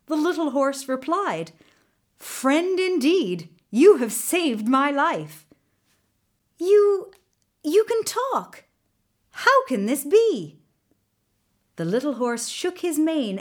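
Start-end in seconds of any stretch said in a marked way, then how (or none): 2.2-5.5 high, drawn-out grateful horse; storybook horse giving lofty thanks; grateful exclamation. A narrator's reading. Rescued horse thanks his rescuer. 6.6-10.6 high, loud, halting amazement; utterly amazed, pitch leaping up; astonished. A narrator's reading. Amazed that the horse talks.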